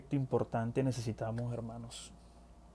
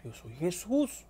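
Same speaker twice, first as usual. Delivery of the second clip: soft, slow, higher reply; voice of Jesús; 5 semitones higher, 3 dB quieter, slower than usual. Acted. Jesús reveals himself to Saulo, soft acted voice. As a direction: answer softly and slowly, lightly acted. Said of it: "y él le dice".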